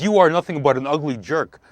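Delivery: insulting stranger. Acted. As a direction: hurl it like an angry passer-by.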